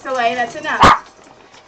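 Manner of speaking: loud, slow firm warning to the dog; firm command. Real, not acted. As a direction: stop the dog firmly. Telling the dog to stop.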